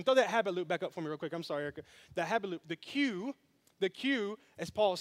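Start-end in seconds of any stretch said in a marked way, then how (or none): none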